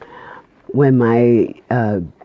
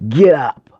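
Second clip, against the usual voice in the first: loud, higher-pitched imitated wake-up command; lightly voiced booming order from nowhere; sudden loud call to wake someone; commanding mystery voice; 6 semitones higher, 7 dB louder than usual. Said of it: "It was a masculine-like voice".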